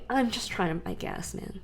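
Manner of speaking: whispered aside, under the breath; hushed aside. Real, not acted. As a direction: drop to a whisper for the gripe. Annoyed aside to listener.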